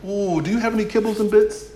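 high, chirpy dog without a care; giggly pet voice asking for treats; carefree dog voice. Retold or imitated. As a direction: voice the happy oblivious dog. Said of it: "She's like".

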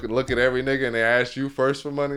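ordinary talk, nothing marked

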